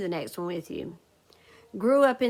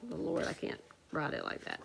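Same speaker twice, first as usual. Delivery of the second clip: whispered aside; 5 semitones lower, 8 dB quieter, faster than usual. Real, not acted. Teacher's aside over her writing.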